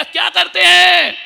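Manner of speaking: high loud yes; mock shout. Monk shouting back at the wife, mock loud.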